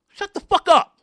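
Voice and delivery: loud, high-pitched angry yell; sudden exasperated shout; angry outburst. Real, not acted. Angry retort to imagined pleader.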